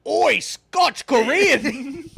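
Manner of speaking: loud, high mock-Scottish shout; slow, hammed-up Scottish holler; Scottish accent. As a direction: bellow in a put-on Scottish accent. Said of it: "do a Scottish accent".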